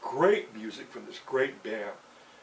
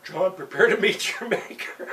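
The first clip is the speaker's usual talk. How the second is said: higher, louder solemn angel voice; angel's warning; 7 semitones higher, 7 dB louder than usual. Retold or imitated. Angel warning John before the gunshot, solemn and loud. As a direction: announce his doom like a heavenly messenger. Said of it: "my angel says".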